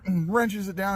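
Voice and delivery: ordinary talk, nothing marked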